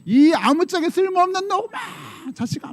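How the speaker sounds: put-on high, slow, shrill-pitched yell at a child; angry scolding. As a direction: scold the kid in a mock rage. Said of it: "막 너무 화가 난 거야". (화난 엄마가 자식을 야단치며 높게 소리침)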